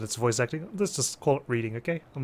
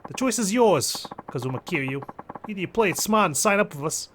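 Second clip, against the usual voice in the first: Japanese mafia man gone Jersey; Jersey accent; 5 semitones higher than usual. Acted. Mobster offering a fighter a choice, Jersey accent. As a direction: lean on him in a Jersey accent. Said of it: "I don't know why a Japanese mafia member has a Jersey accent".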